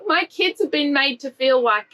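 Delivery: usual speaking voice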